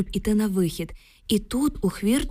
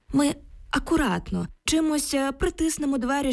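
higher, tentative proposal; placating suggestion; 7 semitones higher than usual. A narrator's reading. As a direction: offer a careful fix, pitched up. Oksana's friend placating her aloud. (примирливо пропонує)

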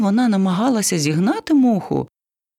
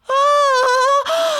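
very high panicked scream; parrot scream; 4 dB louder than usual. A narrator's reading. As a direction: shriek in alarm. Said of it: "зарепетував папуга". (переляканий вереск)

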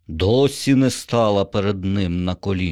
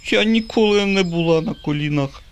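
put-on much higher, bold little girl's voice; 10 semitones higher than usual. A narrator's reading. Little U telling Bob she never knelt, girlish voice. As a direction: answer like a fearless small girl.

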